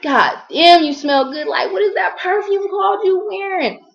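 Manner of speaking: high-pitched, awed gushing; imitating the admirer. Retelling a stranger asking about her perfume, high awestruck imitation. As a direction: mimic a man bowled over by a scent. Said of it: "he was like".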